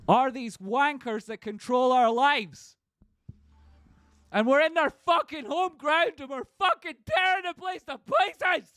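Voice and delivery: very high, slow, straining shout; raging peak of the rant; furious yelling. Real, not acted. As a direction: scream it like tearing the place apart. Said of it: "sorry for getting so angry".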